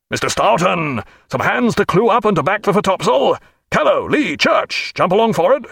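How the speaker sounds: high barked commands; shouted orders. A narrator's reading. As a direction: call the hands across the deck.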